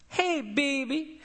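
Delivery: slow, high Charlestonian drawl of a grandmotherly lady. Retold or imitated. Lab nurse greets the pastor at the door: slow high Charlestonian drawl. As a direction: stretch it out in a sweet Charlestonian drawl. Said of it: "has this thick Charlestonian drawl".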